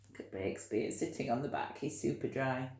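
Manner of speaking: usual speaking voice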